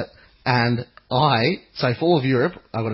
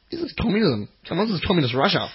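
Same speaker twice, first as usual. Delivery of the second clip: higher-pitched mock indignation; retold indignant protest; 7 semitones higher, faster than usual. Traveller recalling visa paperwork, indignant mock protest.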